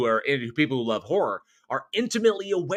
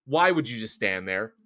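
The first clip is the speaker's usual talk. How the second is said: usual speaking voice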